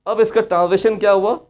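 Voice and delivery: usual speaking voice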